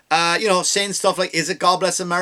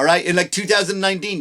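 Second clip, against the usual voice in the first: ordinary talk, nothing marked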